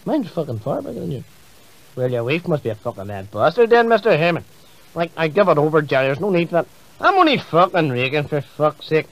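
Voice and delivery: usual speaking voice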